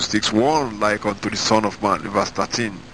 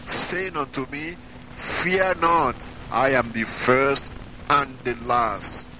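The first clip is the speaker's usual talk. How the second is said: raised, slow solemn reading of scripture; slower than usual. Acted. Preacher to congregation, reading Revelation in solemn raised voice. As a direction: read the verses out like holy proclamation.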